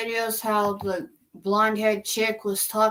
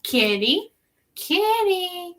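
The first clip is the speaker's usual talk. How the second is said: put-on child voice; 9 semitones higher, slower than usual. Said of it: "she goes".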